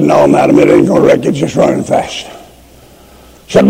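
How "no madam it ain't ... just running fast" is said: calm conductor. Retold or imitated. Conductor calmly answers the woman.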